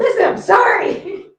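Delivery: loud, high, drawn-out sorry; exclaimed apology. Real, not acted. Answering a friend who says she's blind, loud exclaimed apology. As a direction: cry out the apology, slow and high.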